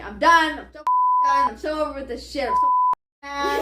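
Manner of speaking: loud mock rant, pitch climbing; loud, high rant of a hangry friend; angry rant. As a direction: imitate her furious lashing out. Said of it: "like, lashing".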